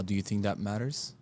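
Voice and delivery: usual speaking voice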